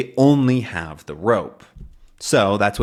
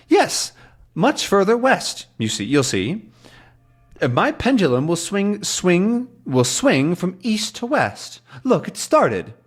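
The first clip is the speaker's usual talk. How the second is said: much higher, eager professor voice; old professor; 8 semitones higher than usual. Retold or imitated. Calculus shows his pendulum swinging, much higher voice. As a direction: hold it high and pleased like a dotty scientist. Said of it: "he says".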